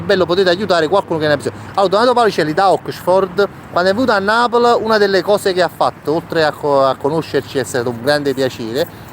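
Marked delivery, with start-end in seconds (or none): none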